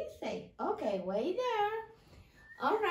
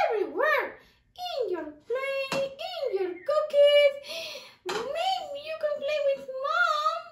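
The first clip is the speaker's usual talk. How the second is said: much higher, louder mouse cheer; excited puppet mouse; 7 semitones higher, 4 dB louder than usual. Acted. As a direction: squeal with delight as the mouse. Puppet mouse explains circles.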